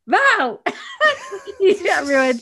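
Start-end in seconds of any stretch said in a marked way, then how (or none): 0.1-0.6 high, loud mock amazement; mock wow. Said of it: "she's like". 0.6-1.6 shrieking laugh, much higher; a real high laugh bursting out; high burst of laughter. Real, not acted. Laughing at friend's face.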